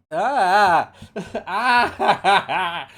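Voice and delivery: bursting into loud laughter; laughing. Real, not acted. Laughs about Christmas pressure.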